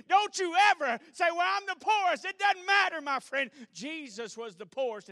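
loud, high, impassioned preacher's cry; full-force preaching. Acted. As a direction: preach it out to the whole room, ringing.

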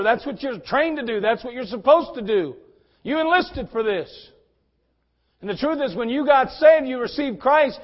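fiery preacher climbing to a shout; full-force preaching. Acted. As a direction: preach it hard, building to a roar.

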